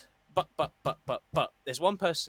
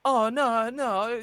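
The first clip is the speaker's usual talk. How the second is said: higher, louder caught-out denial; sheepish mock fan; 8 semitones higher, 6 dB louder, much slower than usual. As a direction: play the fan who got caught out. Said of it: "people who've been going".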